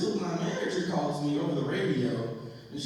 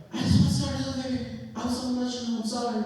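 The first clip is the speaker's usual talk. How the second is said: high, whiny imitation of an ex; mock pleading; 8 semitones higher, faster than usual. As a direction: plead in a put-on higher voice. Imitates ex begging to start over.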